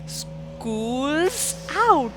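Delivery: plain reading voice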